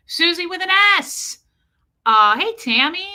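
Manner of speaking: high, loud call-out; loud, high-pitched hail; excited shout-out. Real, not acted. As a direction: holler the name like a greeting.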